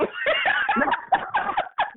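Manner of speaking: big shrieking laugh bursting out. Real, not acted. Telling a friend a wild work story, shrieking laugh. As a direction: cackle high at the memory.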